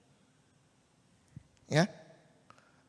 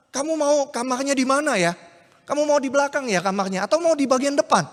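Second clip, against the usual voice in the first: higher, bright eager voice of a happy fiancé; 6 semitones higher than usual. Acted. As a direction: greet her gladly, showing off the house. [name] cheerfully greets [name]. (tunangan yang senang menyambut [name], suara tinggi ceria)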